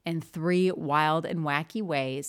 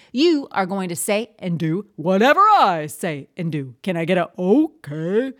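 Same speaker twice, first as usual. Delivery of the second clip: high, loud classroom announcing voice; a teacher rallying her students; teacher voice to class; 4 semitones higher, 6 dB louder than usual. Shown as a demonstration. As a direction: address the whole class, bright and big. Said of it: "here's how I introduce it".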